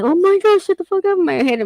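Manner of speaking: high-pitched exasperated blurt; exasperated outburst. Retold or imitated. Mother fed up with son's nonstop pestering, exasperated. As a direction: let out a fed-up inner cry, voice jumping up.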